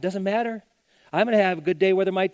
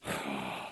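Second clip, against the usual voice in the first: deep sigh; 13 dB quieter than usual. Retold or imitated. Worried person sighs over stocks.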